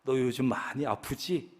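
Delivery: a hint of a hushed tempter; Satan quietly tempting a believer, mildly hushed; Satan whispering. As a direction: lean in and coax softly, not fully whispered. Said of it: "속삭입니다".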